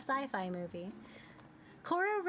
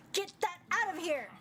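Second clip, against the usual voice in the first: character yelling; 7 semitones higher than usual. Acted. Character recoils from sun flower.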